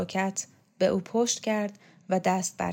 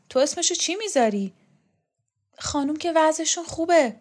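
much higher, louder startled questions; alarmed disbelief; 6 semitones higher, 5 dB louder than usual. Acted. Rokhsareh hears the house is auctioned, higher and alarmed. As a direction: react in shock. Alarmed servants argue about sale.

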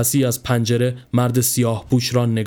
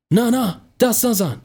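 higher, sharp upset cry; upset shout; 10 semitones higher than usual. A narrator's reading. Soldier crying out to his brother not to touch, higher and upset. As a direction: stop your brother, pitch jumping up. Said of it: "ناراحت شد و داد زد".

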